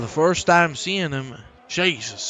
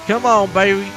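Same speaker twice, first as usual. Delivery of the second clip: loud, raised-pitch rallying call; urging cheer; 5 semitones higher, 8 dB louder than usual. Real, not acted. Commentator cheering on his man on track, loud urging. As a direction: call it out to spur the driver on. Repeated louder urging of the driver.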